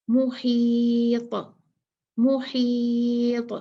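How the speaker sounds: मुहीत very slow, stopping on a bounced ta; qalqalah stop on ta. Shown as a demonstration. Teacher showing students the stop on मुहीत. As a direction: draw out मुहीत and bounce the final ta. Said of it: "मैं इसको सुकून दूंगी".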